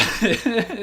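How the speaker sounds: bright burst of laughter, high and loud; quick real laugh; short laugh. Real, not acted. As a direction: laugh out at your own joke. Laughs at own joke.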